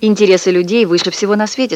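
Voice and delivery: plain reading voice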